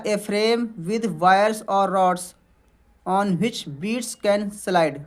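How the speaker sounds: English read very slowly, word by word; slow dictation. Shown as a demonstration. Teacher reading a definition aloud to students, very slow. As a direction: spell it out slowly for the class.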